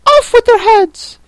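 very high, loud death sentence; piercing royal fury; shrieking Queen. Acted. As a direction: scream it like a tyrant queen. Queen orders executions.

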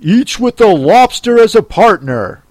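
high, loud Gryphon cry. A narrator's reading. Gryphon adds to the dance steps, crying out. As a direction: call it out with swooping pitch. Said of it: "cried the Gryphon".

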